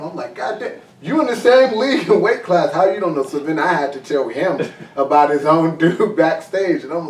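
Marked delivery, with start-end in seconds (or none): 0.3-3.2 incredulous. Retold or imitated. Retelling his own disbelief to a fighter.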